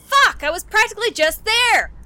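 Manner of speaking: loud exasperated outburst; frustrated shout. Real, not acted. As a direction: yell it, kicking yourself. Realises he was just there.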